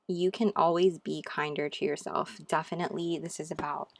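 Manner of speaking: ordinary delivery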